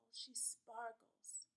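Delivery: slow, breathy whisper. A narrator's reading. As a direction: whisper it slowly, in awe. Dreamy moment in new dress.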